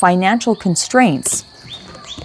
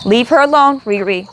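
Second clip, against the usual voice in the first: scolding the dog; 6 semitones higher than usual. Real, not acted. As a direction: tell the dog off sharply.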